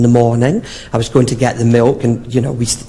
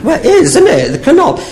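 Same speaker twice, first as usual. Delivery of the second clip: very high, laughing prim lady; high mock lady; 3 dB louder than usual. Dotty woman realising the answer, high and laughing.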